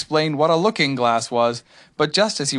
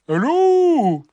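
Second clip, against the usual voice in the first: loud, high drawn-out call; strange animal; 8 dB louder than usual. A narrator's reading. Strange animal answers Pooh outside, loud whooped greeting. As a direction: whoop it back as an unknown creature. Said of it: "said the strange animal".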